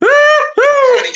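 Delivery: exclaiming. Retold or imitated. Telling her friend how angry the agent made her, loud outburst. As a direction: burst out at the memory. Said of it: "I got so damn angry".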